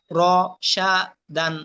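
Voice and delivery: র, সা, দান one syllable at a time; broken syllables. Shown as a demonstration. Teacher drilling learners in broken pronunciation, syllable by syllable. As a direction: break র, সা, দান apart with pauses. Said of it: "ভাঙ্গা উচ্চারণ করবেন একটা একটা করে".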